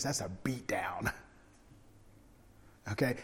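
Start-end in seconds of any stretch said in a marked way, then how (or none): 0.0-1.1 hushed whisper of a punchline; whispered comic aside to the congregation. Acted. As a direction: lean in and whisper it. Aside about scourging.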